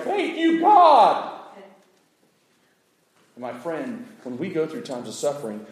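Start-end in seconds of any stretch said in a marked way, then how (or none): 0.0-1.3 much higher, loud, relieved thanks; grateful exclamation. Retold or imitated. Lion's side thanking God, high and loud. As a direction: cry out thanks like the fed lion. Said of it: "you're like".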